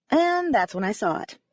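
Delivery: ordinary delivery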